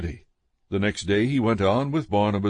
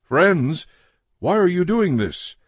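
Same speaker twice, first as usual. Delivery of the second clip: higher-pitched, lightly raised appeal; mild narrated shout; 7 semitones higher, 3 dB louder than usual. A narrator's reading. Apostles rushing into the crowd, mildly shouting. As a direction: call out with restraint, pitch lifted.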